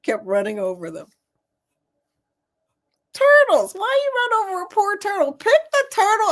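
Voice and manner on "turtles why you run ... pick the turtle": high scolding exclamation. Retold or imitated. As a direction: chide him in a high pitch. Scolding the grass cutter.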